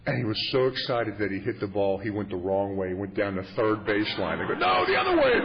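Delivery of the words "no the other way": high, urgent crowd shout; yelled correction. Retold or imitated. Onlookers yelling to a kid running the wrong way, high shout. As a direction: yell it high and loud to turn a runner around.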